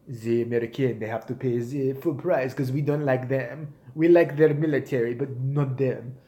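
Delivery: a lightly put-on French accent, sniffy and dismissive. Mocking how the French see Americans.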